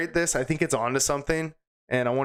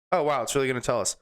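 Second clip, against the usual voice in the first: normal talking voice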